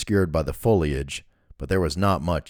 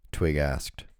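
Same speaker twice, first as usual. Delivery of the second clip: ordinary delivery, nothing marked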